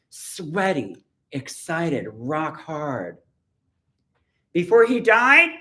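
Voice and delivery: a poet performing her own poem; poetry reading. Acted. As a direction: declaim each line to the room. Reads her poem aloud.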